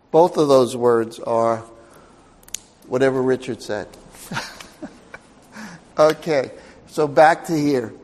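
ordinary talk

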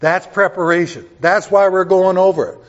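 loud emphatic point; preaching. Real, not acted. Pastor to church, loud emphasis. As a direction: hammer it home loud.

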